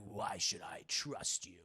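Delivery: low, slow whispered villain voice; hushed, mock-sinister question; suspicious Electro. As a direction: whisper it with wary menace. Electro distrustful in acted exchange.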